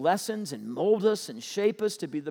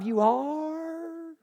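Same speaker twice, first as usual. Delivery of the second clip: mock disbelief; 11 semitones higher than usual. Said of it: "you're like".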